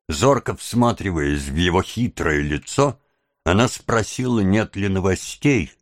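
ordinary delivery

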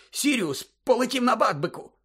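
high, elated burst of news; breathless relief. A narrator's reading. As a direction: blurt out the triumph breathlessly. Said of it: "видихнув Гаррі". (радісно, на видиху)

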